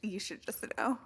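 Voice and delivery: half-whispered, pointed reply; soft, whispery comeback; hushed retort. Pretend. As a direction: say it under your breath, pointedly. Mock-grumpy reply to friend's question.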